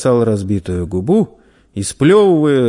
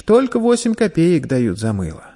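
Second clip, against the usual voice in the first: put-on high, resigned child's voice; sighing; 7 semitones higher than usual. A narrator's reading. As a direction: explain it with a boy's regret. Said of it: "вздохнул мальчик".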